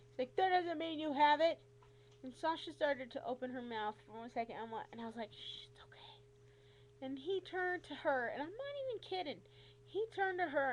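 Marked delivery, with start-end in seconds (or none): 0.4-1.6 loud, higher mocking retort; dismissive challenge. Doctor disputing his patient's diagnosis, loud and dismissive. 5.3-6.2 very soft, whispered reassurance; whispered hush. Retold or imitated. Patient hushing Sasha beside her, whispered.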